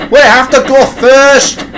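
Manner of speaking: high, drawn-out protest; shouted. A narrator's reading. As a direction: shout it slowly and urgently. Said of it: "he shouted".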